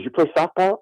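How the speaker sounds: high-pitched quoted question; imitating the doctor. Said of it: "He goes".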